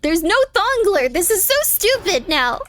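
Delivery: high, drawn-out gamer frustration; frustrated outburst. Real, not acted.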